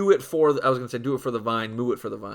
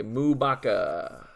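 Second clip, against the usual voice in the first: ordinary talk, nothing marked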